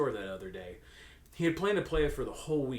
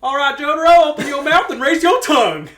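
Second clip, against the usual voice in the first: much higher, much louder caring doctor voice; hammed-up Kakyoin; 19 dB louder than usual. Kakyoin ordering Jotaro to open up, hammed-up. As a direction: overplay a fussy nurse. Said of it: "Kakyoin came back with the thermometer".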